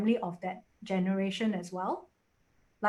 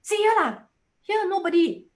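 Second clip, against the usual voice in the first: put-on high, louder kid calling out; imitated child; 10 semitones higher, 6 dB louder than usual. Child picking a seat at McDonald's, imitated high call. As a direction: point it out eagerly like a small child.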